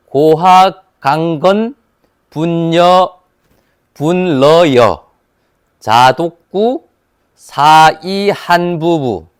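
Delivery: sentence read syllable by syllable in reverse, slow; backwards speech. Shown as a demonstration. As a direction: sound out each reversed syllable, haltingly. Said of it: "거꾸로 읽어볼 테니까 한번 보세요".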